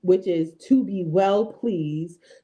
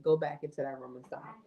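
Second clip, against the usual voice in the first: real under-the-breath scolding; hushed parental aside; 5 semitones lower, 10 dB quieter, faster than usual. Real, not acted. Aside to child off camera.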